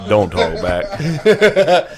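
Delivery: slow, drawn-out, laughing scold; mock scolding. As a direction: stretch it out like a teasing parent. Teasing scolding a friend.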